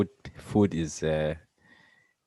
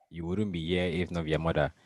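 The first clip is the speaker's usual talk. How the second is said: ordinary delivery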